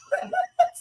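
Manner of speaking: shrieking laugh close to crying; real high-pitched cackle breaking into a sob; high teary laugh. Real, not acted. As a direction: laugh till the tears come. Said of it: "tears coming out my eyes".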